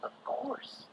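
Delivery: soft unvoiced whisper. A narrator's reading. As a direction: breathe it out quietly. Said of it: "said Robin".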